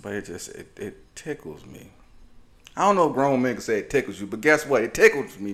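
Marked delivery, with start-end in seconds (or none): 0.8-1.9 quiet take. Real, not acted. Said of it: "too much stank". 4.8-5.5 loud, sassy emphasis; exaggerated sassy punch; with stank on it. Real, not acted. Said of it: "I said it with a little too much stank on it".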